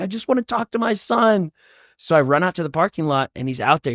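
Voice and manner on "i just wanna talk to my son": high-pitched, overwrought retold plea; very emotional. Dad held up by churchgoers, very emotional plea. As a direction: plead it high, acting overwhelmed. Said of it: "I'm getting very emotional".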